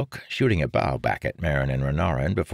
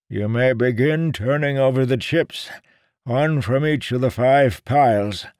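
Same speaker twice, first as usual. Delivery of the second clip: put-on higher old man's voice; old seer voice; 9 semitones higher, 4 dB louder than usual. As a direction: ask it like a fussy old seer.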